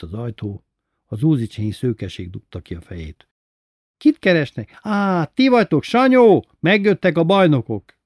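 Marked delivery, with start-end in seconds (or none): 4.0-8.0 high, loud calling-out; merry shout. A narrator's reading. Blonde at the door calling Sanyó to greet visitors, merry shout. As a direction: shout it high, building on the name. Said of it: "kiáltotta nevetve románul".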